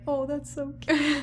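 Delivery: high, slow, adoring coo; drawn-out, high-pitched fawning; mock cooing. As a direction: gush as if over a cute child. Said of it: "sitting there like".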